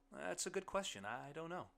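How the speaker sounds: high, light, uncertain answer; lifted voice, at a loss; stumped. A narrator's reading. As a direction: pitch it up, unsure. Narrator character stumped by question.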